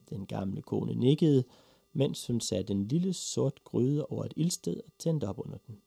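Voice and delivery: ordinary delivery, nothing marked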